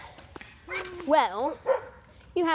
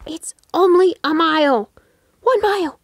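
loud, high, fed-up yell; exasperated shout; 6 semitones higher, 10 dB louder than usual. Real, not acted. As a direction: shout at the complaining runners. Coach scolding runners.

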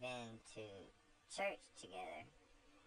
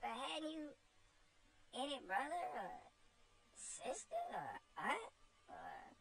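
put-on much higher, gentle pleading child; tearful little Eva; 13 semitones higher than usual. Eva pleads with Topsy, high tearful child's voice. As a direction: coax her kindly, close to tears.

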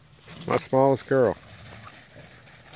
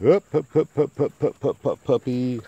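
high, quick excited ups; rally the puppy with bright calls; 7 semitones higher, 4 dB louder than usual. Real, not acted. Owner greeting the puppy, high excited calls.

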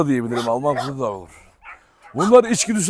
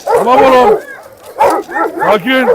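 loud, higher commands to the dogs; stern shouting; 12 semitones higher, 6 dB louder than usual. Real, not acted. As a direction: hold the dogs back, raising your voice. Separating fighting dogs. (köpekleri ayırırken sert bağırma)

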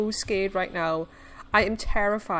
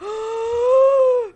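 loud, high gasp of fright; high, startled intake of breath; frightened gasp; 12 semitones higher, 7 dB louder than usual. Real, not acted. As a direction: gasp sharply in panic. Creature appears, player panics.

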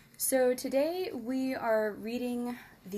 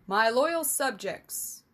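slow, louder, lifted public-speech voice; royal address; 2 semitones higher, 5 dB louder than usual. Acted. King addressing the crowd from the balcony, practiced smile. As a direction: project it like a forced royal greeting. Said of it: "addressed the crowd with a well-practiced smile".

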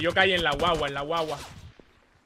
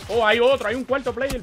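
loud, high alarmed callout; alarmed shout; 8 semitones higher, 8 dB louder than usual. Real, not acted. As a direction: yell the warning to the squad. Spots surprise fourth enemy.

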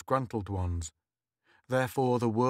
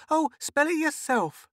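put-on high-pitched impatient child; snapped; 4 dB louder than usual. A narrator's reading. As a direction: snap it out in a high little-girl voice. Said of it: "then snapped".

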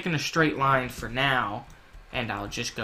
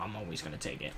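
"I'm always gonna take it" said much softer and lower, trailing off; quiet correction; 6 semitones lower, 12 dB quieter, faster than usual. Real, not acted. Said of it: "Uh, well, at least right now".

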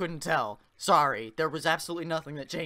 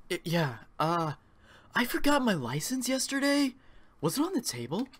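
lightly voiced Paul; 9 semitones higher than usual. Voicing Paul.

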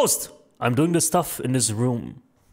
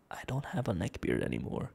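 whispered denial; 5 semitones lower, 14 dB quieter than usual. Real, not acted. Denying a donor's neck beard joke.

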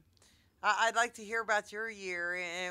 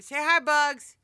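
loud, higher-pitched call to a pet; bright-pitched loud coaxing call; 5 semitones higher, 16 dB louder, slower than usual. Real, not acted. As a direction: urge the pet to speak, loudly. Calling to pet Bugs to talk.

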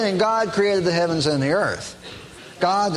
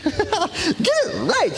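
laugh, then high stretched ridicule; mocking echo; much slower than usual. Preacher laughing at the line he can't stand, mocking. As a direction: draw it out very high, mockingly amused. Laughs, mocks the quoted phrase.

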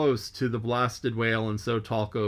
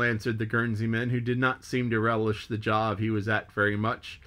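ordinary delivery, nothing marked

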